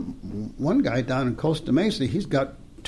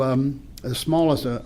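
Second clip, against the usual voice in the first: usual speaking voice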